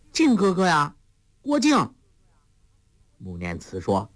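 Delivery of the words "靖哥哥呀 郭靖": put-on sweet girl voice. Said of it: "黄蓉说".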